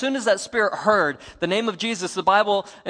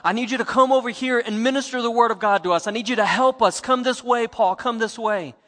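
loud pleading call across the river, higher; 6 semitones higher, 7 dB louder than usual. Retold or imitated. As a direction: call out to Paul from far away, urging him over. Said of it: "he's calling out to Paul".

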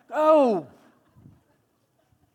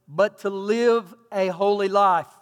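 slow reading; slower than usual. Shown as a demonstration. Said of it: "The New International Version says".

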